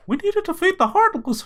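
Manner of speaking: very high squeaky cartoon mouse; Mickey Mouse voice. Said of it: "says really important stuff in Mickey Mouse's voice".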